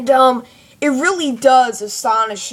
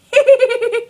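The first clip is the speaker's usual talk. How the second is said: high-pitched insane giggle; loud manic weasel cackle; Psycho; 13 semitones higher, 6 dB louder than usual. Shown as a demonstration. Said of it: "Some kind of insane laugh".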